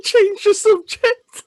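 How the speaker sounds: slow, high, squeaked panic; high squeaky panic. Retold or imitated. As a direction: squeal it out in alarm. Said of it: "I was like".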